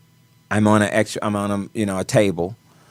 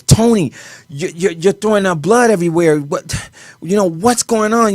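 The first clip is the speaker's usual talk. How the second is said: freaking out; 8 semitones higher than usual. Retold or imitated. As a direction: retell the friend's panic, pitched up and pushed. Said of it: "he's, he's freaking out".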